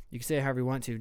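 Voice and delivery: ordinary delivery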